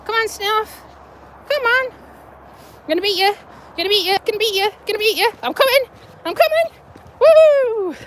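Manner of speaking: very high, excited pet-talk voice; playful egging-on. Real, not acted. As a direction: tease and chase, rising to a whoop. Playfully racing Snuff, urging him on.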